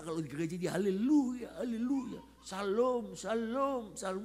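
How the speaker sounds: high, loud, effusive church greetings; mock pious churchgoer. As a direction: sing out greetings with showy piety. Mimics wife's pious church greetings. (tiruan jemaat saleh yang heboh)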